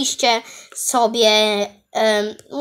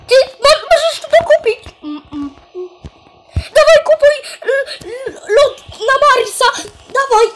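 excited shouting; 11 semitones higher than usual. Real, not acted. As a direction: shout at him to buy now. Urging friend to buy.

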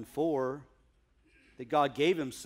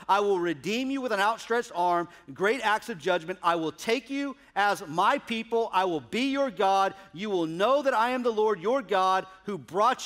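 raised, ringing pulpit voice reading scripture; preaching; 7 semitones higher, 7 dB louder than usual. Acted. Preacher proclaiming God's words to the congregation, fervent preaching.

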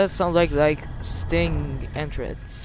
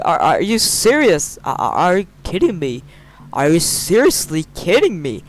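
loud disbelief; 5 semitones higher, 8 dB louder than usual. Real, not acted. Gamer shouting in disbelief at the free full game, building to a high wail. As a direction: yell it louder each time, last one stretched high.

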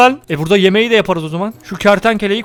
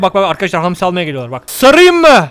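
ordinary delivery

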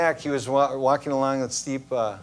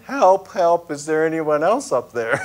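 louder, higher, laughing through it; hammed-up cry for someone else; mock yell for help; 3 semitones higher, 6 dB louder than usual. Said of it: "he yelled".

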